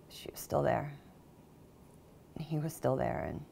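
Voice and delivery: very soft, near-whispered confession; stunned. Acted. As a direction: trail into hushed shock with long pauses. Stunned confession after the smothering.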